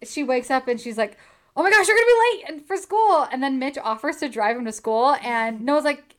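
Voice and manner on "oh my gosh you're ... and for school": imitating a flustered mother, high, loud and fast; mock panic. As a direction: blurt it out in a playful rush of alarm. Voices mother waking up late.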